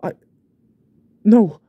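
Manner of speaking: high, loud horrified outburst; desperate loud cry; anguished cry. Acted. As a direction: cry out in panic at your own deed. Man horrified at what he did.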